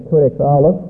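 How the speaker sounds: ordinary delivery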